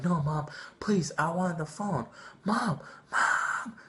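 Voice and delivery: put-on a kid losing it in the store, voice cracking upward; retold tantrum yell. Kid refusing to calm down, yelling for mom, rising high. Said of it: "I just started yelling at the top of my lungs".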